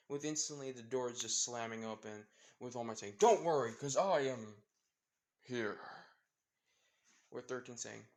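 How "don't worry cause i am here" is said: booming hero announcement; loud, grand superhero reassurance; All Might's heroic entrance. Acted. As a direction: proclaim it loudly, dropping back on the last word.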